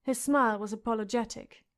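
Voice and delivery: ordinary delivery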